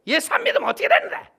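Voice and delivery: high, fast, scornful retort; bitter Hamgyeong woman. Retold or imitated. Angry woman rebuffing the evangelist, scornful high retort. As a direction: spit the words back in scorn. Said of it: "이 한경도 아주머니가 그러더래".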